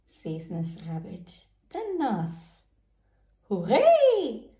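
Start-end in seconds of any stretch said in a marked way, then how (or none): 3.5-4.5 loud high hooray; children cheering at the dentist. Acted. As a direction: cheer with joy. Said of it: "They both cheer".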